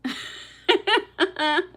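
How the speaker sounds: high loud burst of laughter; loud, high laugh at the chat. Real, not acted. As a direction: crack up out loud.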